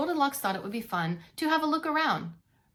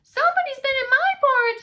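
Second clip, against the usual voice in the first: very high loud whiny cub voice; Baby Bear discovering his empty bowl; squeaky Baby Bear; 8 dB louder than usual. Acted. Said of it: "said Baby Bear".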